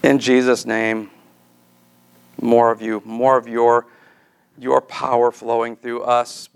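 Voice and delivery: steady, earnest prayer over the congregation; closing prayer. Acted. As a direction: pastor praying for the church, earnest prayer voice.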